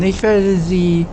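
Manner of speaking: ordinary talk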